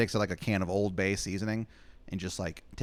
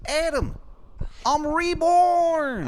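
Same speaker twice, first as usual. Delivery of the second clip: very high, loud, slow falsetto; Shithead Steve reborn; 7 dB louder, slower than usual. Acted. Steve returning at the door to Adam, loud high falsetto. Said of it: "He goes".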